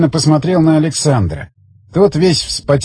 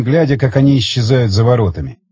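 usual speaking voice

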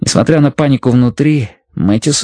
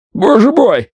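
blurted cry; 12 semitones higher, slower than usual. A narrator's reading. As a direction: blurt it out in shock. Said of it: "бухнул он".

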